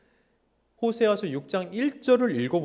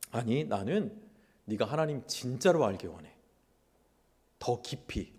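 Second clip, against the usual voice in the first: tender voice of God; 4 semitones lower, 5 dB quieter than usual. Acted. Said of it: "번역하면 이러는 거예요".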